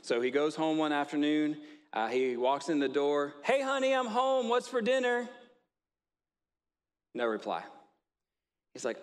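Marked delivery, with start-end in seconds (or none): 3.4-5.3 very high, loud greeting call; yell. Retold or imitated. Husband shouting a greeting to his wife as he walks in. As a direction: belt it out from the door, way up high. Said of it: "just scream, uh, yell pretty loud".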